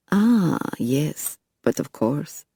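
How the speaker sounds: lightly put-on French accent, curious; a hint of a French vampire sizing her up. A narrator's reading. Bouchard voiced, French accent.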